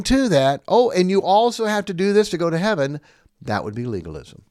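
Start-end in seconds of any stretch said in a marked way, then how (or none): 0.7-3.0 mock legalist voice, louder and higher; mocking quote. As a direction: parody the add-on rule with raised voice.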